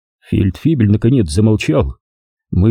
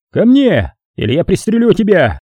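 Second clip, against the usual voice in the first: furious threat; 9 semitones higher than usual. A narrator's reading. Hals threatening Lindberg, high angry yell. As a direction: threaten him to get moving. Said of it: "рявкнул Гальс".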